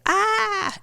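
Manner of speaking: a sudden high yelp of alarm; mock alarmed cry. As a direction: squeak it out like a brain in panic. Brain panicking in stress response.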